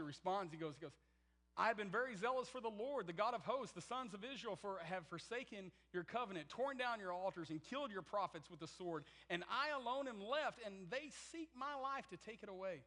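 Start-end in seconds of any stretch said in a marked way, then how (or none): 1.6-12.8 louder, higher, pleading protest; hammed-up prophet defending himself; Elijah's indignant complaint. Elijah's complaint voiced loudly.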